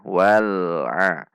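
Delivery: "wal'a" with a moderately held l; correct lam sukun. Shown as a demonstration. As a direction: join "wal'a" without break or overlong l.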